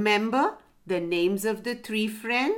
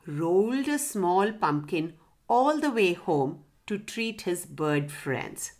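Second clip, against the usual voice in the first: plain reading voice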